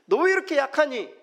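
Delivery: high, loud scolding question; reproach. Retold or imitated. The Lord imagined scolding a weak believer, loud reproach. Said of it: "라고 말씀하지 않으시고".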